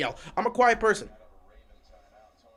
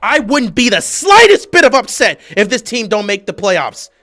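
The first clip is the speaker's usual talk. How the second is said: loud, raised-pitch fan rant; ranting yell; 6 semitones higher, 13 dB louder than usual. Real, not acted. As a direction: yell your take in exasperation. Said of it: "I'm not screaming at you".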